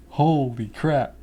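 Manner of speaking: usual speaking voice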